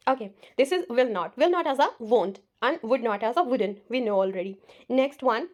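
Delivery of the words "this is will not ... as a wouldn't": ordinary delivery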